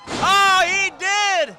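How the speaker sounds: rising to a loud excited yell; excited shout. Acted. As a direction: erupt as the move lands. Commentator erupts at high spot.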